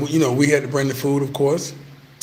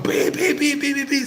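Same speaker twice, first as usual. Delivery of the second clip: high beeping car horn sound; imitated horn beeps; 10 semitones higher than usual. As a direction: mimic a honking truck horn, high.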